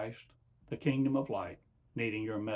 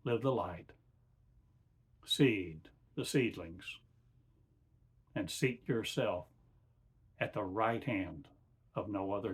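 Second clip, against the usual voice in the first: channeled divine voice. Acted. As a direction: speak as God through a man, measured and calm. Channeling God in slow sermon.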